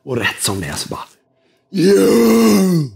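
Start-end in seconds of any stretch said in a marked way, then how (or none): 1.7-2.9 high, booming call across an arena; hammed-up shout to the back row; loud, drawn-out showman's summons; imitating big-stage speaker. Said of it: "Han pratar ju så".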